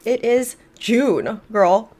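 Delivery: normal talking voice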